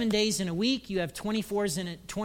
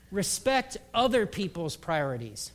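usual speaking voice

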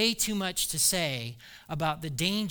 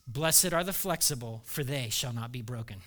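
quoting mentor; 8 semitones lower, 5 dB quieter than usual. Retold or imitated. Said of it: "He would say".

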